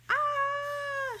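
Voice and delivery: high, frightened yelp; squeaky high startled ah; scared yelp. Acted. Character scared by bird.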